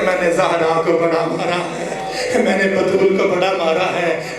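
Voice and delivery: slow, gloating boast with a laugh in it; the killer taunting, slow and laughing; mocking killer's laugh. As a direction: gloat over the beating, laughing. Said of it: "ہنس ہنس کے کہتا تھا".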